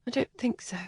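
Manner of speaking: a soft, doubtful whisper. Acted. Helen whispers back to Kathleen, unconvinced. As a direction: whisper the refusal. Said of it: "Helen whispered".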